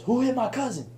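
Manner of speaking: high-pitched mock demand; acted-out confrontation without extra volume; retold challenge. Relative confronting the crowd.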